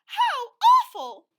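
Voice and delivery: very high, slow, loud mock roar; cartoon bear roar. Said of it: "roared the polar bear queen".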